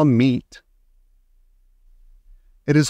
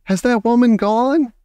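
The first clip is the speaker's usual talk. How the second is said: frightened shout; 10 semitones higher than usual. A narrator's reading. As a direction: call out the fearful question. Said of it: "I shouted".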